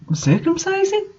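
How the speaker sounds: high, soft disbelieving echo of circumcising; incredulous repeat. Real, not acted. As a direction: say circumcising as if you can't believe what you read.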